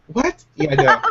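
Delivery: high, sassy brush-off; mocking shoo. Acted spraying scene, shooing someone.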